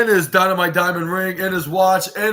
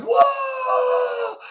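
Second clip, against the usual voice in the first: a sudden, very high and far-off "whoa"; a crowd's stunned high-pitched whoa; amazed whoa. Retold or imitated. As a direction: yelp it out like the whole arena just gasped. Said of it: "It was like".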